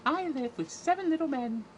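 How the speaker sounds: very high cartoon princess voice; hammed-up falsetto princess. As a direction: pipe it up like a Disney princess.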